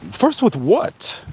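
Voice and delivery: high, slow, disbelieving rise; sarcastic high query; incredulous question. Real, not acted. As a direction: drag it out in scornful disbelief. Mocking the "we were first" claim.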